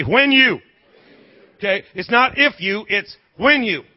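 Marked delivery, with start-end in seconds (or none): 0.0-0.6 louder prompt. Shown as a demonstration. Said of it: "Come on, say".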